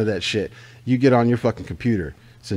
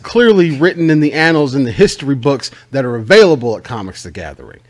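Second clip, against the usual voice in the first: mock rant; 4 semitones higher, 8 dB louder than usual.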